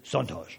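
"Santaj" said softly with a nasal "San" and the final j dropped, "Sahn-tah"; schoolmaster's own pronunciation. Retold or imitated. Pastor imitating how the Haitian schoolmaster says his own name, soft. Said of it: "or as he says it".

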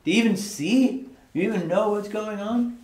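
pitched high, asking God in disbelief; exasperated questioning. Retold or imitated. The lamenting prophet demanding of God whether he even sees, exasperated. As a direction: throw the questions up at God, incredulous.

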